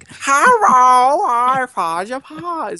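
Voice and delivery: high, slow Cockney bloke voice; hammed-up Cockney accent, drawn out. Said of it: "my Cockney bloke's kind of".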